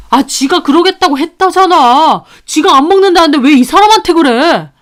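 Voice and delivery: loud, high irritated pushback; defensive son. Acted. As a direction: argue back at his mother. 며느리 역성 들며 저한테 따지고.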